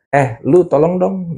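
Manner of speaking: a hint of a mother calling for help; mom asking. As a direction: mom asking her kid for a favour, lightly voiced. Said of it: "misalnya nyokap lu nyamper".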